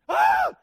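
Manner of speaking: very high, very loud comic scream; shriek. Retold or imitated. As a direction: scream like a terrified teenager. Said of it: "we know he screamed".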